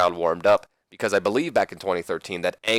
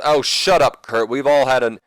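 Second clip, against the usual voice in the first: acted irritated retort, higher and louder; Chris Benoit; 5 semitones higher, 6 dB louder than usual. Champion answers his challenger, acted irritated outburst. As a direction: cut the rival off in a mock promo. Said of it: "And then Benoit says".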